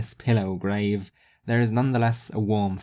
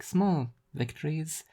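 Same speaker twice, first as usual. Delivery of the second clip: a touch higher, slow, understated; wry small victories; 6 semitones higher, 4 dB quieter than usual. Acted. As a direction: let it land softly and dryly. Rhea repeats phrase.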